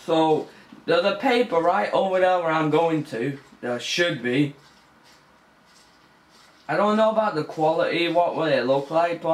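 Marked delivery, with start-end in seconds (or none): none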